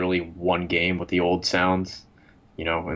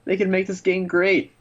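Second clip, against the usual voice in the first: heated rant; 9 semitones higher than usual. Real, not acted. Ranting about Valve.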